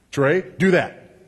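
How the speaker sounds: slow, loud parental command; firm order to a child, drawn out; parent giving orders. Retold or imitated. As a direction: order your child, slowly and loudly. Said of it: "I used to do it through counting".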